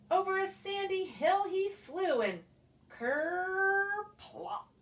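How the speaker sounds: ordinary delivery, nothing marked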